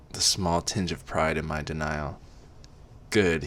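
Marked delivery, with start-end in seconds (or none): none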